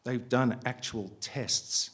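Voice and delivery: slow, with "tests" drawn out and softened; emphasised last word. A narrator's reading. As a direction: linger gently on "tests". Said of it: "emphasising the last word, elongating it, softening it".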